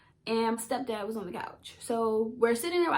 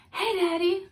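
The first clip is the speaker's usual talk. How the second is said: put-on high, sweet little-girl greeting; retold high-pitched hello to a parent; daughter greeting dad; 8 semitones higher, 4 dB louder than usual. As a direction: call out to Daddy in a lifted voice. Retold hopeful call to her dad.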